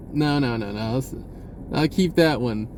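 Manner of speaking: normal talking voice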